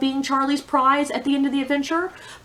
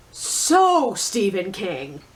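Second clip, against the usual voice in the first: sarcastic emphasis; 4 semitones lower, much slower than usual. Real, not acted. Mocking the author's typical style.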